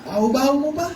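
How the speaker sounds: high-pitched annoyed hushing; acted crowd telling off a beggar; shushing scold. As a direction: shush the nuisance with open irritation. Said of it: "ang sabi ng mga iba".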